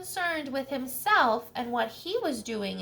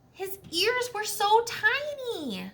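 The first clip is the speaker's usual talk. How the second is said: dismayed; 9 semitones higher, slower than usual. Acted. Armadillo sees his shrunken ears.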